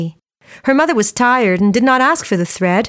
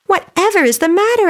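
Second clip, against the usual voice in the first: high, startled question; surprised mother; 9 semitones higher than usual. A narrator's reading. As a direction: ask in sudden surprise. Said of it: "in surprise".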